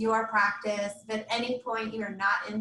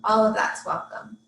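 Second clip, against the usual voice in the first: measured meditation guide, long pauses between phrases; guided meditation. Real, not acted. Yoga teacher settling students into Yoga Nidra, guided meditation. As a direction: guide them gently into rest, leaving space after each instruction.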